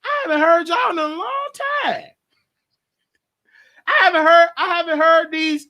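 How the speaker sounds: mock talking back. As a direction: keep talking back to the voices. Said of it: "I'm talking back to her".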